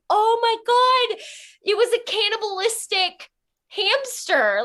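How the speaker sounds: high, loud retold horror; horrified. Woman recounting finding a cannibal hamster, high horrified outcry. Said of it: "terrified and disgusted in my life and saddened".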